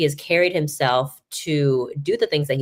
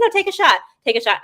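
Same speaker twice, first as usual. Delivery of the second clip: high, eager push to shoot; mock urging; 13 semitones higher than usual. [name] urging reporter to retry shot, high eager push. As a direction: coax her to shoot again, pitched up.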